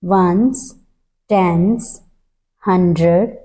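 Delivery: slow counting. Shown as a demonstration. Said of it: "मैं धीरे धीरे बोल रही हूँ".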